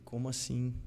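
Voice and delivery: soft, low retold disbelief; hushed, incredulous question; stunned, hushed reply. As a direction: drop to a small, stunned voice.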